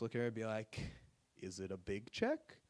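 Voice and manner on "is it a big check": soft, slow, low dopey question; deadpan innocence, drawn out and hushed; acting clueless. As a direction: play dim, asking slowly and softly. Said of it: "I've acted as clueless as possible".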